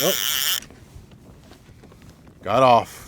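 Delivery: sudden loud dismayed yell; loud outburst. Real, not acted. Fish escapes.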